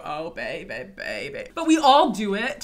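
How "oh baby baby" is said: low, breathy, fried [name] line. Retold or imitated. Coach imitating [name]' low fried baby. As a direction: drop deep into fry on baby. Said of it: "[name] used to go".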